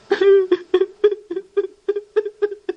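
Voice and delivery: a burst of high laughter. Acted. Reb Berel laughs in the story.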